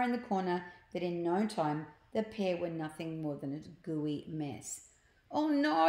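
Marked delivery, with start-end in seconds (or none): 5.3-5.9 high, loud "oh no"; hammed-up dismayed wail; alarmed exclamation. A narrator's reading. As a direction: cry out in storybook dismay. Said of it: "exclaimed Slime".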